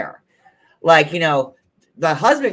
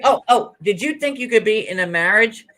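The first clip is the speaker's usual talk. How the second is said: furious sassy rant; 4 semitones higher, 3 dB louder than usual. Retold or imitated. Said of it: "Christine's like".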